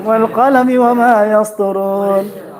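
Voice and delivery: a short chanted recital; Quran recitation. Acted. Teacher recites a verse to the class in chant. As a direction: intone the verse, measured and flowing.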